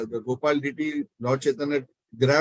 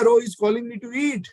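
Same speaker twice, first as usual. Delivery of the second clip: put-on high, eager little-boy exclamation; imitating a child thrilled to be called; delighted child; 8 semitones higher than usual. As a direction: pipe up eagerly, pitched well up. Little Nimai hears the prayer, delighted.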